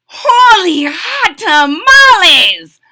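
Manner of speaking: loud, high, stretched-out cry of amazement; amazed exclamation. A narrator's reading. Skippy shoots past his reflection, loud amazed exclamation. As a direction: exclaim it big and slow, way up high. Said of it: "exclaimed Skippy John Jones".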